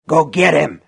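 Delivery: loud, forceful command; harsh cry. A narrator's reading. Shopkeeper inciting customer to attack, harsh cry. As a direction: urge him on harshly. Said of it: "he cried in a low, harsh voice".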